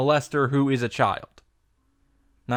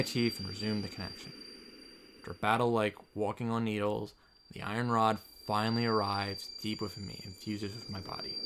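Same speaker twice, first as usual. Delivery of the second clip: softer, absorbed reading of the hero's thoughts; game text reading; 11 dB quieter, faster than usual. Acted. Streamer reading the hero's inner thoughts aloud, softer. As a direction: read the inner monologue gently.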